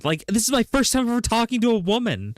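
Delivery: high, starstruck gushing; hammed-up thrilled caller; imitating excited customer. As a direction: gush way up high in mock amazement. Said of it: "he sounded like".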